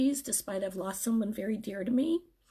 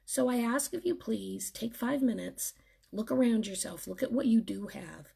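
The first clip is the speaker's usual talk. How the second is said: usual speaking voice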